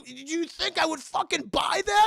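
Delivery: high, loud mock fury; acted shout of offended disbelief; indignant shout. Fooled father challenges impostor, indignant shouting.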